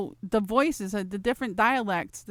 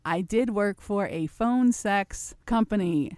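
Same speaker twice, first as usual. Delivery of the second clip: usual speaking voice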